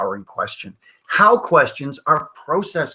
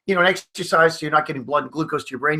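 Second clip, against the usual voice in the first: ordinary delivery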